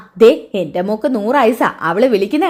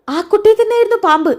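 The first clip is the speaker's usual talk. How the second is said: much higher, baffled protest; surprised insistence; 11 semitones higher, faster than usual. Retold or imitated. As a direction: insist in disbelief that it was there. Son insists snake was there. (അമ്പരപ്പോടെ ഉയർന്ന ശബ്ദത്തിൽ)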